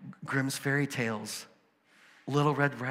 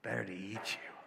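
a hint of a menacing wolf, lower and softer; big bad wolf; 5 semitones lower, 12 dB quieter than usual. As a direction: growl it quietly as the wolf about to pounce. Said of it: "And the wolf says".

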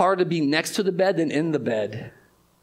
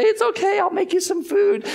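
much higher, brittle cheerful mock voice; forced cheerful wife; 3 dB louder than usual. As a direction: pretend all is fine for him. Voicing Job's wife pretending.

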